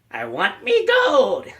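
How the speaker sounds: high, slow leprechaun growl; Warwick Davis leprechaun. Retold or imitated. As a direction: squeeze it up into a greedy little leprechaun. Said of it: "I'm gonna do an impersonation of Warwick Davis".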